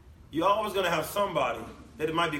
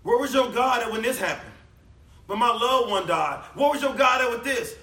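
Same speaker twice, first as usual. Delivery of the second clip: mocking skeptic; 5 semitones higher, 5 dB louder than usual. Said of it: "When people mock you".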